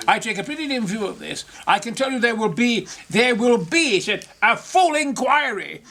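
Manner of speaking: a light stab at the Speaker; mimicking the Speaker. Retelling the Speaker's words after the protest, pompous. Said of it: "I'll never forget the Speaker saying".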